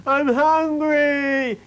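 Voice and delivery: put-on high, loud, slow whining child; whiny little girl. A narrator's reading.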